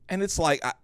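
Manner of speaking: ordinary talk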